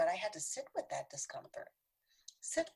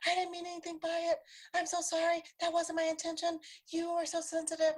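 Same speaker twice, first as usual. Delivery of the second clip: higher and louder, brushing it off; defensive denial; 9 semitones higher, 7 dB louder than usual. Retold or imitated. The offender rejecting the complaint, high defensive protest. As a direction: protest your innocence, pushy and pitched up. Defensive reply to being called out.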